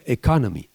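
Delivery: "Economy" stressed on "con", English style; stressed second syllable. Shown as a demonstration. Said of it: "l'accent tonique". (accent tonique sur « con » de « Economy »)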